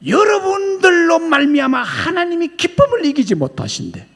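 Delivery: high, loud preaching cry; preaching shout. Real, not acted. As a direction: proclaim it at full force. (회중에게 외치는 설교)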